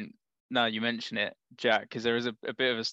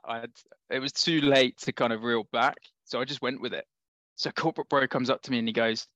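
usual speaking voice

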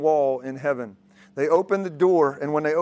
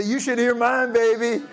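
crowd-led boast; 6 semitones higher than usual. Shown as a demonstration. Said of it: "Come on, say that with me".

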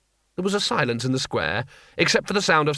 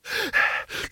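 sharp alarmed gasp; a quick breathless gasp of alarm; 4 dB quieter than usual. A narrator's reading. Two Flower rushing in with news, alarmed gasp.

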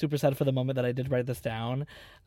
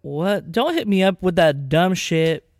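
exasperated telling-off; 3 semitones higher, 9 dB louder, slower than usual. Pretend.

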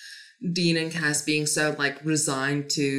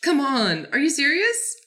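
higher, louder come-on, are you serious; exasperated disbelief; 9 semitones higher, 4 dB louder than usual. Real, not acted. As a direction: scoff in real disbelief. Exasperated at the politicians.

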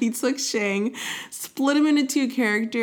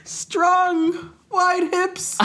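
higher, louder hammed-up character line; brash character voice; 10 semitones higher, 3 dB louder, much slower than usual. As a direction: ham up a boastful soldier, pitched high.

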